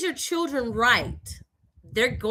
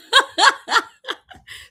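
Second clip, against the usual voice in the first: high laugh; 12 semitones higher, 7 dB louder than usual. Real, not acted. Storyteller laughing about the ex-husband's new wife, high giggle.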